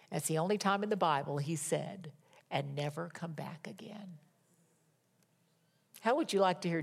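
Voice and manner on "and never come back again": hushed, firm whisper; a soft whispered order; whispered command. Acted. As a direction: breathe the command out low and unvoiced.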